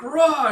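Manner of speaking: loud, high battle yell; battle roar. Acted. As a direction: roar as you lunge. Warrior attacks.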